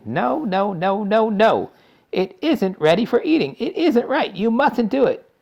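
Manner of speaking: high, loud, anxious protest; fretful pleading. A narrator's reading. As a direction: plead with her, wringing your hands. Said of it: "wringing his hands and saying".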